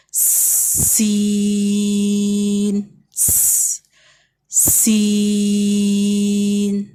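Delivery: letter names held long in a chant; drawn-out recitation. Shown as a demonstration. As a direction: chant each letter slowly. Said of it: "اس letter سے start کریں گے".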